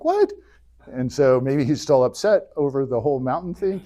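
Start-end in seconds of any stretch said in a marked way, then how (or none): 0.0-0.3 shocked what. Retold or imitated. Mock shock at God.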